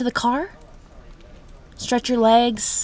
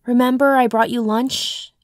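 ordinary talk, nothing marked

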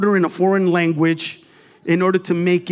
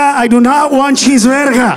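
emphatic refusal; 5 semitones higher, 5 dB louder than usual. Acted.

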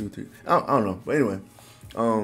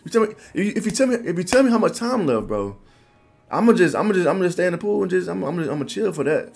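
high, loud retold protest; exasperated pleading; 9 semitones higher, 5 dB louder than usual. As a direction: act out a worn-out swimmer bargaining. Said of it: "I'm like".

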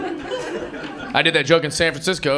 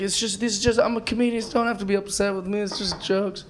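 soothing explanation; 3 semitones higher, 6 dB quieter than usual. Retold or imitated. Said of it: "it's like".

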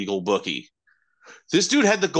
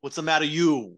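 a light Italian lilt on the phrase; mock Italian accent; 2 semitones higher than usual. Cohost echoes the Italian saying in a light mock accent. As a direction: toss it off like a pizzeria regular. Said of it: "with an Italian".

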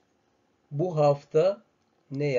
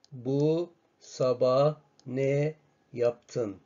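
slow question; much slower than usual. Shown as a demonstration. Teacher models question slowly.

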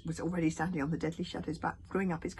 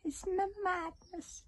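muffled; slower than usual. Acted. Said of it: "muffled voice of Toothless".

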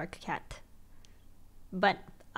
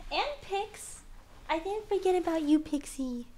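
higher pet-talk voice; talking to the cat; 8 semitones higher than usual. Real, not acted. Reassuring Pixie he isn't forgotten, light pet-talk.

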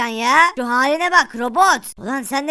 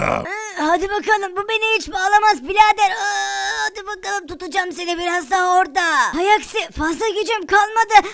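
hammed-up magician straining; spell-casting wizard; 5 semitones higher than usual. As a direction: push the magic up with effort. Holding the spell up.

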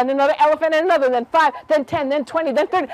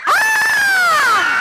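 long, piercing mock scream; high scream; 4 dB louder than usual. Shown as a demonstration. Storyteller showing listeners the rabbit's cry, high scream. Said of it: "Can you scream?".

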